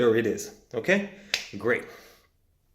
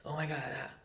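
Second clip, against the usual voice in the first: hushed gasp; 2 semitones higher, 13 dB quieter, faster than usual. Shown as a demonstration. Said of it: "You want this weird".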